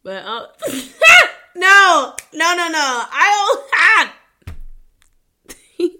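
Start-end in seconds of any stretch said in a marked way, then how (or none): 0.5-1.5 high, loud cackling laugh; loud burst of laughter. Real, not acted. Bursts out laughing at her own line. 1.6-5.9 high, loud no's through laughter; laughing protest. Real, not acted. Laughing denial after a laugh.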